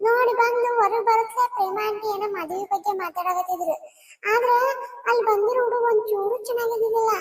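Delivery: put-on a small kid breathlessly reporting; child voice.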